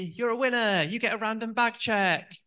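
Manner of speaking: high, loud cheery machine announcement; self-checkout machine voice. Retold or imitated. The self-checkout screen announcing a bag check, in a loud, high cheery machine voice. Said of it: "and the screen said to me".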